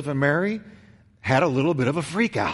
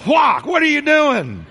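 much higher, louder, slow scolding shout; angry mother shout; 9 semitones higher, 5 dB louder, slower than usual. Retold or imitated. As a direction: yell at the lost son in fury. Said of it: "It was".

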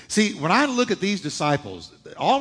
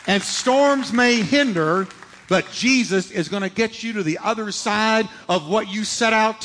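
preaching; 3 semitones higher, 3 dB louder than usual. Acted. Preacher assuring the congregation, fervent build. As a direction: rise to a fervent finish.

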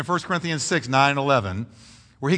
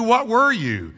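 ordinary talk, nothing marked

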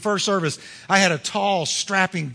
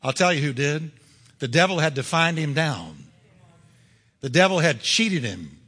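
normal talking voice

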